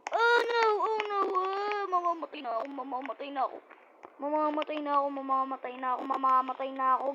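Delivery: high, loud panicked cries; panicked wailing. Real, not acted. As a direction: wail that you're about to die. About to die in game.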